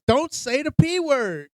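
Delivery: high, slow, exasperated imitation; frustrated scolding. Older brother to little brother in public, frustrated. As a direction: stretch it out high, a kid at the end of his patience. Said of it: "He was getting fucking frustrated with his brother".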